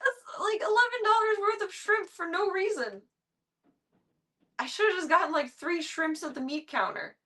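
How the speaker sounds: high, whining half-sob; whiny near-crying. Real, not acted. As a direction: wail about the wasted money, voice breaking high. Upset over wasted shrimp.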